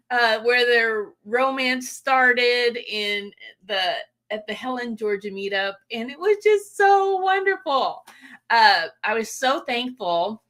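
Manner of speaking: ordinary delivery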